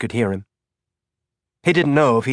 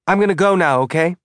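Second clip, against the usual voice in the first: put-on higher, eager boy's voice; young boy voice; 7 semitones higher, 4 dB louder, faster than usual. A narrator's reading. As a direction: play a hopeful young kid.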